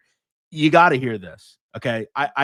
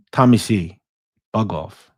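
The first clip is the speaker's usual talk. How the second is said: ordinary delivery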